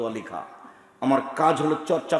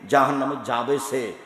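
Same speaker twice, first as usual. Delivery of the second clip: usual speaking voice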